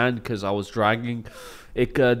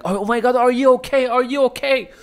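high, far-off fussing voice, much higher; mock-concerned high woman; worried woman; 12 semitones higher, 5 dB louder than usual. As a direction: squeal it like a panicked stranger. British woman fussing over him.

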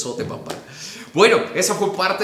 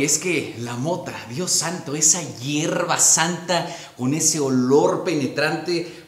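usual speaking voice

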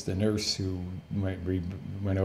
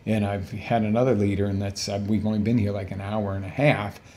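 ordinary talk

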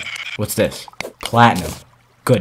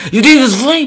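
"You think it's funny?" much higher, shrieking, rising at the end; furious shriek; 6 dB louder, faster than usual. Real, not acted. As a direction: blow up completely, voice shooting up. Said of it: "I just lost my temper".

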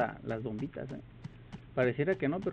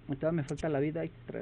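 normal talking voice

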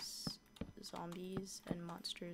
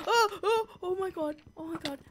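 loud, high yelps of alarm; panicked cries; 11 semitones higher, 14 dB louder than usual. Real, not acted. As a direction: cry out high in sudden panic. Panicking under attack in game.

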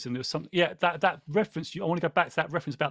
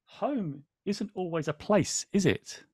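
storybook line; 5 semitones higher, slower than usual. A narrator's reading. Host reading the book to viewers, slow and wistful. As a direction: read slowly as a wistful question. Reads book quote aloud.

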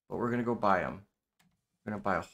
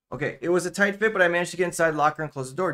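louder, faster narration read-out; game narration read; 6 semitones higher, 6 dB louder, faster than usual. Acted. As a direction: read the game text in a raised, brisk voice. Reads game narration aloud.

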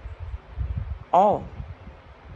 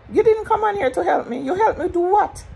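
pitched up and louder, accusing; indignant high outburst; 4 dB louder than usual. Real, not acted. Ranting at the woman who claimed to help, indignant high voice. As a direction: throw it back at her, incredulous. Confronting a former helper.

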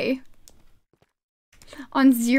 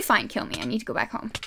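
ordinary delivery

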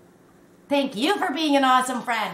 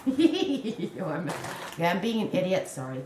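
usual speaking voice

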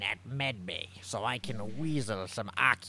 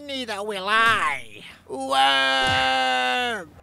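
high slow bellowed war cry; battle cry; 13 semitones higher, 10 dB louder, much slower than usual. Acted. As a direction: roar it out, stretched.

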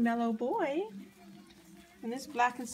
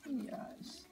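soft whisper; 3 semitones higher, 11 dB quieter than usual. Real, not acted. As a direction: breathe it softly to puppies. Whispering to sleepy puppies.

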